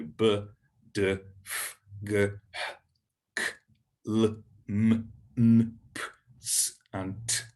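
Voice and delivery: "b, d, f" onward as separate English sounds, not letter names; consonant sounds. Shown as a demonstration. Teacher listing easy consonants, "b, d, f" as bare sounds. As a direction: isolate each sound from "b" to "t", with gaps. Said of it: "the same as we pronounce them in English".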